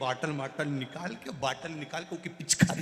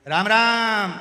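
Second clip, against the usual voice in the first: high, loud Ram Ram; loud shouted greeting; 7 semitones higher, 12 dB louder, much slower than usual. Retold or imitated. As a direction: shout the greeting like a deaf man. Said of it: "वो चिल्ला के बोलता है".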